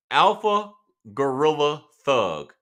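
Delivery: savoring the viewer's name in a big voice; drawn-out hype call. Pretend. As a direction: stretch every word like a ring announcer.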